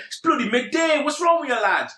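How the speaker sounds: high-pitched Yorkshire bloke; mock a Yorkshire lad, pitched well up; Yorkshire accent. Retelling a plane passenger's complaint, mock Yorkshire accent. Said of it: "on this plane from Yorkshire".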